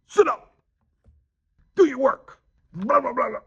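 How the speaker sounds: loud, high exclaimed order; serious command. Shown as a demonstration. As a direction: order it sharply, with an exclamation point. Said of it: "really serious about the command".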